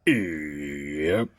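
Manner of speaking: deep, lazy drawled yep; low easygoing farmhand grunt; Big Mac. Acted. Big Mac answering his sister, deep drawled yep.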